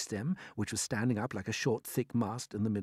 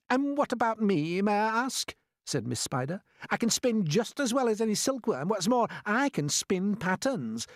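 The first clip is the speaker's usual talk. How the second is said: high, loud, boastful spider lady; Miss Spider, proud; 11 semitones higher, 5 dB louder than usual. A narrator's reading. Miss Spider boasting to the others, proudly. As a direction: brag about your spinning.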